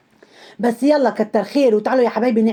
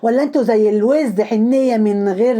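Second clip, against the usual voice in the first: usual speaking voice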